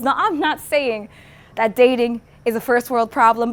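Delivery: spoken word poetry. Acted. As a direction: recite it as impassioned testimony in verse. Performs poem between interview parts.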